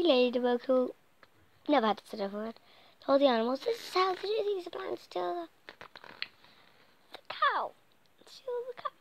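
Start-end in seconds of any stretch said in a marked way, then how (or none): 3.6-8.9 high voice. Acted. Ladybug warns the animals.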